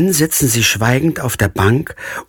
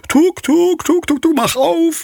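much higher, sing-song clucking call of a hen; 14 semitones higher than usual. Acted. Hen outside the stall begging to be let in, high clucking call. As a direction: call the hen's tuk tuk up high, coaxing the door open. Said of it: "Vielleicht hat sie noch gerufen".

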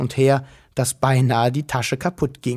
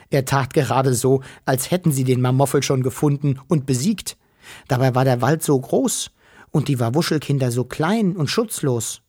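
ordinary delivery

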